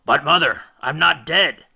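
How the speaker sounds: Odysseus insisting to his mother; protesting. A narrator's reading. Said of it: "protested Odysseus".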